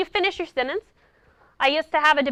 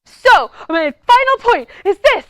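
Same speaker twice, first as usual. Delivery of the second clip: loud, slow, booming mock yell; 7 semitones higher, 11 dB louder, slower than usual. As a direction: boom it at a judge three feet away. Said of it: "he'd be yelling at this poor judge".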